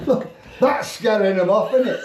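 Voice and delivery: ordinary delivery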